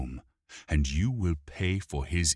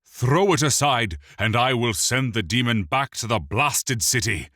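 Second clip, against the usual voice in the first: higher, louder furious yell; 6 semitones higher, 6 dB louder than usual. A narrator's reading. The bleeding elf prince yells to throw the demon aside. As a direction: bark the order to get rid of it. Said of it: "Mirthandir yelled".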